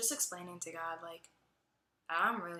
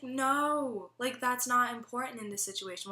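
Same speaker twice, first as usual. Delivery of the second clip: lightly acted emphatic objection, raised pitch; a louder, higher no, then insist; imitated firm correction; 6 semitones higher, 5 dB louder than usual. Teacher to upset student after theft, mildly insistent correction.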